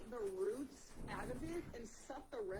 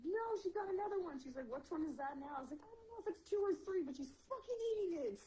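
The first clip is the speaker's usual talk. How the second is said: high, exasperated; 8 semitones higher than usual. Retold or imitated. Reporting to wife, raised voice.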